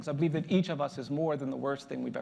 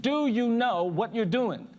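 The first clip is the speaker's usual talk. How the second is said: slow, loud, raised demand; insistent old man; 7 semitones higher, 5 dB louder, slower than usual. Retold or imitated. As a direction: hammer the question out word by word. Old man asks one more time.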